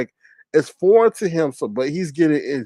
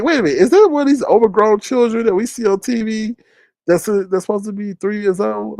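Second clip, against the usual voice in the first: mock astonished; 8 semitones higher than usual. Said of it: "I was like".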